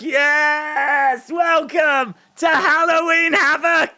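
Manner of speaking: high, shouted announcer welcome; hyped show welcome. Retold or imitated. Host welcoming fans to Halloween Havoc, high shout.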